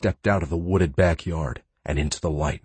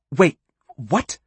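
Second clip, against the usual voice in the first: frightened question; 9 semitones higher, 4 dB louder than usual. Acted. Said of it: "she asked, her teeth chattering".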